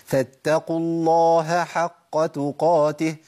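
الله with its ه clearly heard before حق, slow; correct h before ḥ. Shown as a demonstration. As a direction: keep the ه apart from the ح. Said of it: "تاني". (الصواب: إظهار الهاء قبل الحاء)

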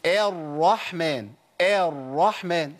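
الرحمن with its opening a drawn out long; wrongly lengthened first vowel. Shown as a demonstration. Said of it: "انت عملت بدل كده، عملت حرف مد الف".

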